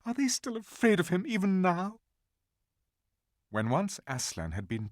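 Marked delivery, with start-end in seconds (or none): 0.0-2.0 sobbing. A narrator's reading. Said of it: "sobbed Susan".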